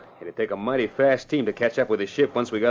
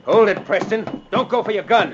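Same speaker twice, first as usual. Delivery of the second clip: armed threat; 8 semitones higher, 5 dB louder than usual. Acted. Crooks holding the ship at gunpoint.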